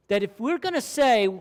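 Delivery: ordinary delivery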